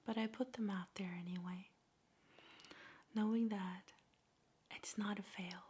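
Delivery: normal talking voice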